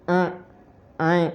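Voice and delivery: अ and ऐ voiced through the nose; nasalised vowels. Shown as a demonstration. Teacher demonstrating nasal vowels to students. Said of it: "इस प्रकार से हम इनका उच्चारण करेंगे".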